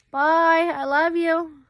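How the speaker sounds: high, loud sing-song farewell; calling out goodbye. Real, not acted. Mom calling goodbye to her leaving son, high and loud. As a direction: call sweetly after him as he leaves.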